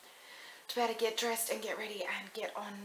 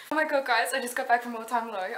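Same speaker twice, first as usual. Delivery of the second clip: excited; 10 dB louder than usual. Real, not acted. Fangirl excitement after concert.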